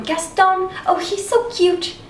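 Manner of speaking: high-pitched fangirl swoon; gushing, high-pitched crush voice; swooning girls. Retold or imitated. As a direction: mimic lovestruck girls, high and drawn out. Mimics swooning townsgirls, sets up contrast.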